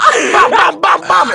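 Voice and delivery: loud high bam bam; gunshot noises. Retold or imitated. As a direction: shout the gunshots. Imitating cop gunshots.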